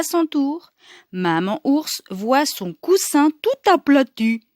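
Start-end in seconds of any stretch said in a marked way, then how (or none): none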